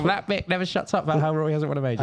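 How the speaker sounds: fast, whiny comment-section voice; mocking a commenter. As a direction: mimic an annoyed viewer. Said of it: "the anger in the comments right now of people saying".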